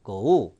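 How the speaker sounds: "go" pulled apart into two beats, go-oo; overdone glide. Shown as a demonstration. Teacher shows how no American would speak, two-beat "go". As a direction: break "go" slowly in two. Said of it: "이렇게 발음하거나".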